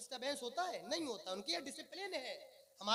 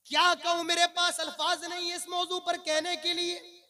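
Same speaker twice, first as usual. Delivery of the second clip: raised, impassioned sermon voice; preaching; 2 semitones higher, 16 dB louder, slower than usual. Acted. Preacher lamenting the state of young men to them, preaching. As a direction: exhort the congregation in ringing, measured phrases.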